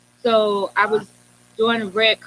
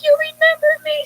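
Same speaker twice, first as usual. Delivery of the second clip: squealed much higher and louder, thrilled; a giddy, high-pitched can't-believe-it question; excited disbelief; 5 dB louder than usual. Retold or imitated. Guest reliving a star remembering her, squealing with excitement.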